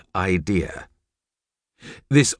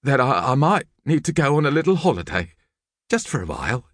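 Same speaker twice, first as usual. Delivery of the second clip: sad sort of voice; 7 semitones higher than usual. A narrator's reading. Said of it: "in a sad sort of voice".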